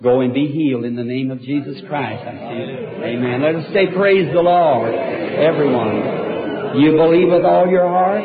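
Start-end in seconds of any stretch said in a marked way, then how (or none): 3.4-8.2 louder, stretched-out call to the crowd; loud rallying call. Real, not acted. Preacher asking the congregation to believe, fervent loud call. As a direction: rouse the room to believe.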